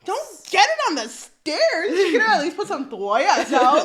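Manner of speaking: laughing mock mom. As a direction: joke the mom's scolding while laughing. Imagined mom nagging, laughing.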